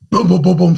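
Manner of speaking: loud, high rapid-fire booms; imitated knocking. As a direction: mimic urgent banging on a window. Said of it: "She knocks on the window".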